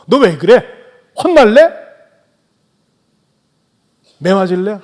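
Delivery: loud, high scolding rebuke; 화난 부모의 꾸짖음. Retold or imitated. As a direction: bark it at a naughty child. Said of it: "막 책망적으로 얘기하지".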